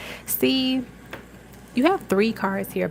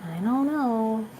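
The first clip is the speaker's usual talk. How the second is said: higher, softer reluctant musing; hesitant quote; 4 semitones higher, 5 dB quieter than usual. Retold or imitated. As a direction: waver as if unsure about trying. Voices hesitant person stung in love.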